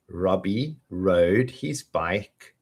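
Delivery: slow, deliberate words; slowly. Shown as a demonstration. Teacher starting the R twister slowly. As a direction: draw each R out slowly. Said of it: "we'll do it slow at first".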